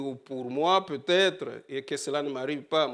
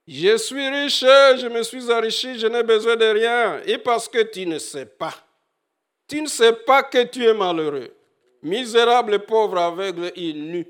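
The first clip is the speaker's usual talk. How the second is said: higher, louder declaiming of the verse; proclaimed scripture; 7 semitones higher, 7 dB louder than usual. Acted. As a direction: cry out the proud boast. Quotes verse with force. (déclamation du verset, plus aiguë et plus forte)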